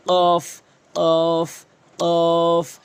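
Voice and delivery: কফ hit loud from the tongue root; pushed qaf. Shown as a demonstration. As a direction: thrust the কফ out forcefully. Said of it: "ধাক্কা দিয়ে কিন্তু কফ". (ধাক্কা দিয়ে জোরে কফ)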